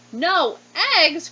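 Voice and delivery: high, loud shocked cry; cried out. Acted. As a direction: shout it in disbelief. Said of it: "cried Farmer Brown".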